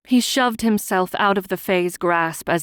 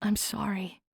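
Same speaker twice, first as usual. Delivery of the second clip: weak whispered apology; 3 semitones higher, 8 dB quieter than usual. A narrator's reading. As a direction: whisper it, barely there. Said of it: "in a too weak a voice".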